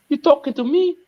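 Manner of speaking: high, mock tough-guy challenge; movie gangster impression. Quoting the short actor, high mock gangster voice. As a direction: squeak the famous line like a tough guy. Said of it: "The actor".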